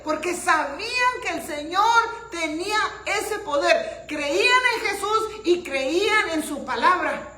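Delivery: loud, high-pitched preacher's delivery; full-force preaching. Real, not acted. Preacher telling the church of the paralytic's friends, full-force preaching. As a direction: drive the story home at full volume.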